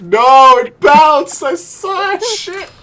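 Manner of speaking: loud cry rising high; outraged protest. Real, not acted. Protesting a bad bounce.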